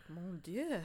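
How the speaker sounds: mock French chef sigh; French exclamation. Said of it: "He's like".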